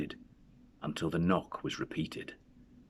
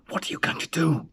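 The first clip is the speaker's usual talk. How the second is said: hoarse breathless whisper, afraid; 10 semitones higher, 3 dB louder than usual. A narrator's reading. Husband holding his wife back, hoarse whisper. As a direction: rasp it under your breath. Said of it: "he whispered hoarsely".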